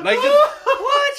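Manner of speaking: very high, loud shocked mother; terrified mom. Retold or imitated. Mom hearing her son is blind, terrified shriek. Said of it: "my mom is immediately terrified".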